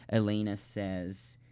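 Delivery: ordinary delivery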